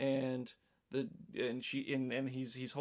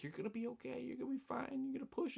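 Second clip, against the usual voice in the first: higher, softer comforting voice; soothing bedside reassurance; 10 semitones higher, 7 dB quieter, faster than usual. Retold or imitated. Husband soothing his wife in labor, softly and higher. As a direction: coax her gently through labor.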